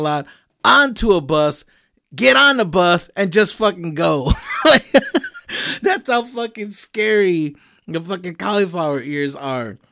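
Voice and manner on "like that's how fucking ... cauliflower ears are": usual speaking voice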